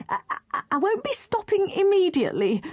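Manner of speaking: high, stammering panic; desperate mumble. Acted. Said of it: "mumbled the crocodile desperately".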